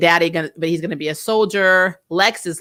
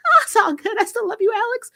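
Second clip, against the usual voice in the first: high, sugary mock voice; mock-sweet girlfriend voice, pitched way up; mocking Kelly. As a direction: squeak it out like an overly forgiving girlfriend. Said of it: "She's just like".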